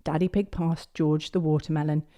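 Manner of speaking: ordinary delivery, nothing marked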